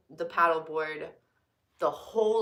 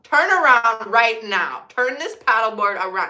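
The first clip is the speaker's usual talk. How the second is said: panicked self-scolding, loud; urgent inner command; 3 semitones higher, 11 dB louder than usual. Retold or imitated. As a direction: snap at yourself to retreat now. Inner voice orders her back.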